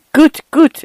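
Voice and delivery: high-pitched, pleased motherly approval; delighted exclamation. A narrator's reading. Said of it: "exclaimed his mother and smiled upon him".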